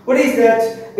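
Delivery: normal talking voice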